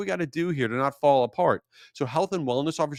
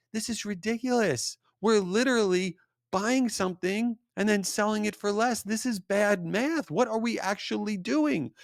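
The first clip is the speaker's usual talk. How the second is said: higher-pitched, incredulous protest about bad math; exasperated dad protest; 10 semitones higher than usual. Dad protesting to his daughter about reselling clothes, incredulous and higher. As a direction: throw up your hands at the silly deal.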